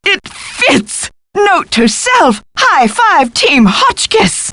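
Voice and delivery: high, drawn-out, self-congratulating glee; triumphant. Acted. Professor cheering her own discovery, high triumphant delight.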